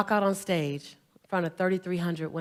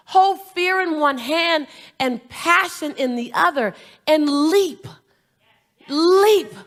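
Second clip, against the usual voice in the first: higher, slow, emphatic rally; motivational preaching; 4 semitones higher, 5 dB louder than usual. Acted. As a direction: preach it to the crowd. Motivational call to audience.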